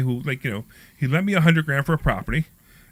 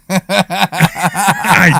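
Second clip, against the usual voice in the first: a louder burst of laughter on one word; laughing; 3 semitones higher, 8 dB louder than usual. Real, not acted. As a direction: laugh it out, caught out. Laughs at being caught.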